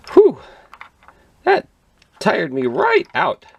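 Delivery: put-on high, slow, worn-out little girl; Goldilocks voice. A narrator's reading. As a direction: sigh it out like a tired girl. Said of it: "said Goldilocks".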